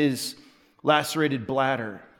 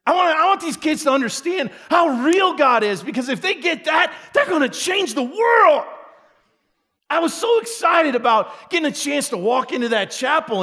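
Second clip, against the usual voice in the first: much higher and louder, shouting; fired-up preaching; 10 semitones higher, 4 dB louder than usual. Real, not acted. Pastor telling the church his dream for young people, fired up. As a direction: preach at full passion.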